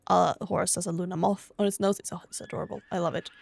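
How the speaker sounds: ordinary delivery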